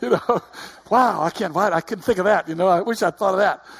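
loud and high, laughing in disbelief; a flustered laughing wow, slowing on the wow; amazed dad laughing at his wife's question; laughing amazement. Retold or imitated. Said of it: "And I said".

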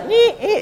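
high thin squeal of escaping air; imitated balloon leak. Shown as a demonstration. Preacher likening sarcasm to a leaking balloon, imitated squeal. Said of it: "like letting the air out of a balloon slowly".

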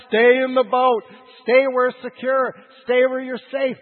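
loud, high preacher's shout; voiced shouting emotions. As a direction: bellow it as the fearful inner voice. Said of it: "it's all gonna shout".

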